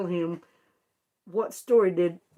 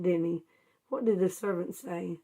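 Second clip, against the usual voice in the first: ordinary talk, nothing marked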